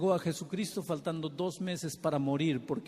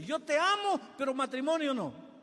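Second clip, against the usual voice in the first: mocking echo; 11 semitones higher than usual. Shown as a demonstration. Preacher mocking a fickle suitor, scornful high echo. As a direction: parrot his dodge with scorn, much higher. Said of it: "¿Qué tipo de amor es ese?".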